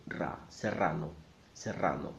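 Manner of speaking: Serrano with a strongly rolled rr; double R. Shown as a demonstration. Teacher drilling the double R, Serrano with rolled rr. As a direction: trill the r in ra and Serrano. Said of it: "an extra effort for the double R".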